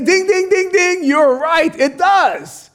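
high, ringing dings and stretched confirmation; mock winner bell. Pastor granting the objector's point, mock-triumphant bell. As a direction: sound off like a correct-answer bell. Imitates a game-show bell for correct answer.

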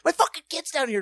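high, loud, rapid furious yell; re-enacted parent's furious high screaming; retold screaming. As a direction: scream at them in outrage. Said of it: "screaming at the ones in the water".

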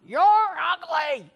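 high, drawn-out parrot squawk. Acted. Parrot insulting the lady a second day, long high squawk. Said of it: "The parrot sees her again".

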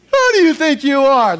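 very high, loud parental outburst; scolding parent. Acted. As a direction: explode like an outraged parent. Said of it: "if you're a parent".

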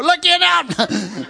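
loud, high, drawn-out comic cry; nagging inner voice. Retold or imitated. Preacher's mind overpowering his worship, exasperated cry. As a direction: burst out, stretching the demand. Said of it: "My mind's going".

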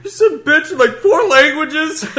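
high laughing protest. Real, not acted. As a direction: laugh through the words. Laughing teasing about email.

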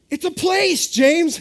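high, loud indignant sleep-talk; sleepy wife. Retold or imitated. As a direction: snap it back as an annoyed half-asleep wife. Sleepy wife voiced by husband.

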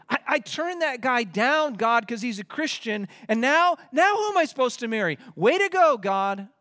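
high, loud, indignant rant; sarcastic complaint to God. Retold or imitated. Single believer griping at God, high and indignant.

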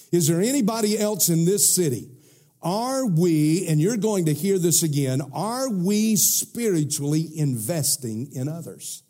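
high, rising sermon cry; fiery preaching. Acted. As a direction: preach it out with urgency. Preaching at full force.

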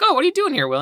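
high, bright mock-girly "oh" to Will; high-pitched imitation of her greeting. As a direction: chirp it up like the surprised girl. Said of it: "she's like".

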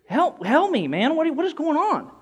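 high-pitched, baffled exasperation; exasperated plea. Retold or imitated. Retold plea over the collapsed man, high and exasperated. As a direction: push the voice up in disbelief.

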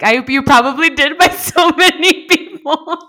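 real laughter breaking in; laughing. Real, not acted. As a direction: laugh through the line. Laughing mid sentence.

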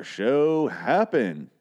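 "show happen" stretched out very slowly; emphasis. Shown as a demonstration. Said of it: "There's an emphasis there".

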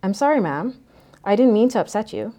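mildly flustered, hurry to make amends; flustered apology. A narrator's reading. Mustache man to the upset mother, flustered apology. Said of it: "his words falling over themselves".

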